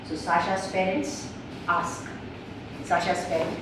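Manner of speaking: normal talking voice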